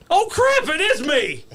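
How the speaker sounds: very high, loud oh crap; high startled realization, then laughing; stunned realization. Retold or imitated. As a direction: yelp it as it hits you. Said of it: "And I'm like".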